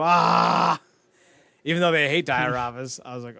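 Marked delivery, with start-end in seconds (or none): 0.0-0.8 loud, drawn-out "ah"; mock group outcry. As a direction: imitate a class crying out together. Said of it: "they all just go".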